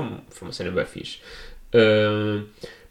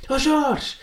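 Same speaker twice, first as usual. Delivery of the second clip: high, strained call to Jorge; hammed-up shout to a friend. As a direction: yell his name again, much higher and in a put-on voice. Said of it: "mandei um berro".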